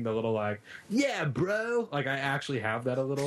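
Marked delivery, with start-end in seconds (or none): 0.9-1.9 high mock Southern California "Yeah, bro"; lightly mocking his own California accent. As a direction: toss it off like a SoCal surfer bro. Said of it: "the horrible California thing".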